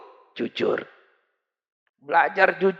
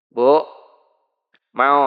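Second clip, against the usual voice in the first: ordinary talk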